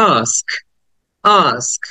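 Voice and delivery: "আস্ক" as "aask", long vowel then "sk"; "আস্ক" with s, then a light k; s before k. Shown as a demonstration. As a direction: model "আস্ক" with a falling open "aa" and final "sk". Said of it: "আয়ের পরে স".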